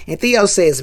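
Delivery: usual speaking voice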